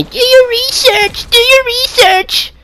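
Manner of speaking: very high, slow mocking whine; whiny mock of the critics; mocking parrot. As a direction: mimic them in a sneering squeak. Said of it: "you keep telling me".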